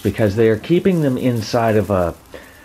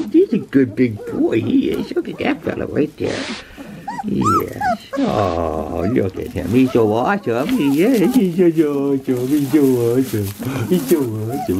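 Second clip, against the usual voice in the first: gushing pet talk; 3 semitones higher than usual. Real, not acted. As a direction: gush over a big dog. Gushing to the dog.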